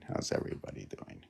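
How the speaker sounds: quick, breathy whispered greeting. Shown as a demonstration. Painter greeting the viewers again in a whisper. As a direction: hush it like a secret hello.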